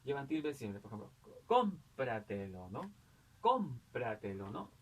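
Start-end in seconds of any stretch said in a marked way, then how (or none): 1.4-2.7 "cómpratelo" with a long, strong CÓM; stress on first syllable. Shown as a demonstration. Teacher giving a sobresdrújula example, stress on CÓM in "cómpratelo". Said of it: "en la sílaba anterior a la antepenúltima". 3.4-4.5 slow "Cómpratelo" with a drawn-out, strong CÓM; stress on first syllable. Shown as a demonstration. Teacher repeating the example for pupils, stress on CÓM in "Cómpratelo". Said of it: "anterior a antepenúltima".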